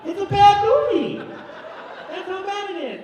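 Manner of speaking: high, drawn-out comic protest; exasperated yelling. Acted. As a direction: burst out loud and high, then drop the volume. Exasperated yelling about the joke.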